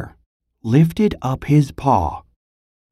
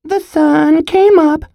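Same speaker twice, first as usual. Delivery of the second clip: high, chirpy squirrel voice; chirped. Acted. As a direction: chirp it out like a happy little squirrel. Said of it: "he chirped".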